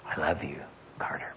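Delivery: low, soft, fading to a whisper; tender hush. Retold or imitated. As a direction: slip into a gentle, intimate near-whisper. God's tender words in retold prayer.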